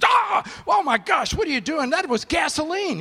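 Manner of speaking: high, loud yelp of shock and outrage; pained shout. Retold or imitated. Doctor yells at the nurse after gasoline drops on his tongue. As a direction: cry out as if your tongue is burning.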